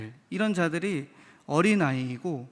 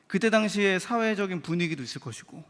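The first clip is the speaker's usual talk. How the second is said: ordinary delivery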